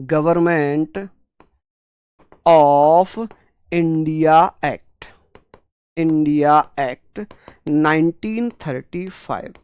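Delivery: "Government of India Act" said word by word with long pauses, very slow; slow spelled-out dictation. Shown as a demonstration. Teacher dictating to students, slowly word by word. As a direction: lay out each word so students can write it. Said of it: "जिसे English में बोलते हैं".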